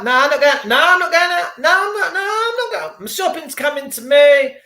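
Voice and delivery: whiny refusal mimicked, climbing in pitch; mocking stubborn relative. Man mocking family who won't leave home, whiny refusal. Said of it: "members of my family".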